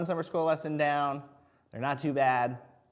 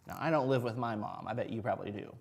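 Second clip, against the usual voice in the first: normal talking voice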